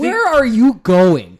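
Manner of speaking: slow, high, loud outraged yell; hammed-up outraged shout; mock shout. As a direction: bellow the question at the driver. Incredulous yelling at other drivers.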